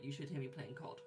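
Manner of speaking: usual speaking voice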